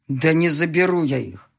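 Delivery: rising anger breaking through; husband frustrated with his wife; starting to boil. Acted. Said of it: "начал закипать он".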